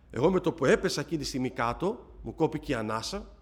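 ordinary talk

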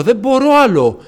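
giving up, begging for help; retold tearful plea. Telling the Lord he can't go on, tearful plea. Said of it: "να κλαίω".

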